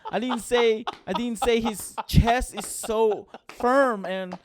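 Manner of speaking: worked-up, comic indignation; exasperated defence. Real, not acted. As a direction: draw out each thing you never said. Mock listing what he never said.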